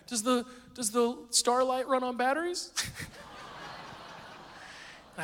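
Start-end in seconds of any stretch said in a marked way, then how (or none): 0.1-2.7 put-on small boy's question. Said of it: "He's like".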